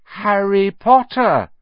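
slow chanted chorus. Acted. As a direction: draw it out together. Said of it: "chorused the twins".

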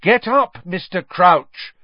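loud clear command; loudly and clearly. A narrator's reading. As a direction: speak loud and clear to a confused man. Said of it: "said Harry loudly and clearly".

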